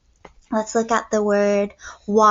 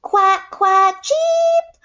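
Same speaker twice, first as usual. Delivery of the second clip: high, loud quacking then a squeaky cheep; duck quacks and chick cheep; 9 semitones higher, 4 dB louder, much slower than usual. Acted. Teacher reading to the class, quacking like a duck. Duck sounds in read-aloud.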